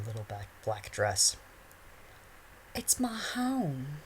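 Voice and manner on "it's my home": high, slow, coy schoolgirl; sweet and coy, drawn out; coy girl voice. A narrator's reading. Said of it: "she smiled coyly".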